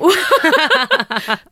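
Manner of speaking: high loud burst of laughter. Real, not acted. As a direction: break into a big real laugh.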